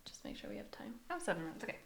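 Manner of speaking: barely voiced, very soft whisper; whispered aside. Real, not acted. Host checking the time mid-recording, whispered aside. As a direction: whisper it off to the side.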